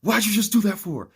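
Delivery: high, loud angry holler; high-pitched mock holler of angry dinosaurs. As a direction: yell it at him in outrage. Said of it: "start actually hollering at him".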